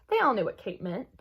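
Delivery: plain reading voice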